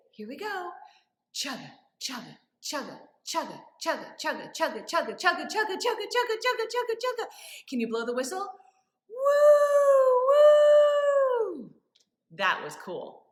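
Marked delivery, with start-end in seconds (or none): 1.3-7.2 chug like a train speeding up; playful train chant; train chugging. Shown as a demonstration. Train noise building up. 9.1-11.7 loud high whistle call; loud train whistle woo. Shown as a demonstration.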